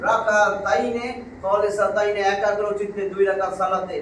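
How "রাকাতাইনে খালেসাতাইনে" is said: slow recitation. Shown as a demonstration. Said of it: "slow recitation for student".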